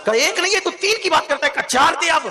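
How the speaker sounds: from lively to high, loud shouting; building exchange. Acted. Preacher escalates the saint's plea for sons, building to a shout. Escalating back-and-forth of pleas.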